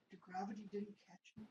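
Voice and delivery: ordinary delivery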